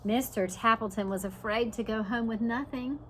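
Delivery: ordinary talk, nothing marked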